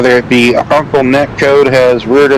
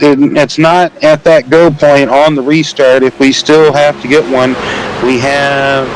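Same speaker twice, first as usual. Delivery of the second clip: play-by-play call; 4 semitones higher than usual. Acted. Calling the restart for race viewers, lively play-by-play. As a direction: lively race commentary, pitched up.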